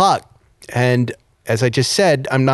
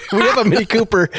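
higher, eager announcement; excited neighbor; 9 semitones higher, faster than usual. Retold or imitated. Neighbor telling him about her Mini, higher and eager. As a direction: burst in with the news. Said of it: "She goes".

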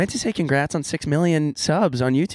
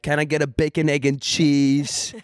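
put-on New York accent. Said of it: "I went full".

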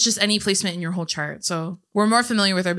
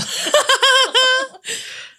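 big high burst of laughter; loud real laugh; 7 dB louder than usual. Real, not acted. Laughing at Taffy story.

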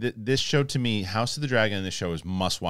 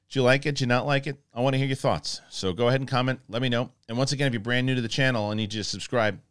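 higher, louder, eager call to the viewers; upbeat outro pitch; 5 semitones higher, 4 dB louder than usual. Acted. Host urging viewers to comment and subscribe, upbeat. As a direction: pump up the audience like a YouTube host.

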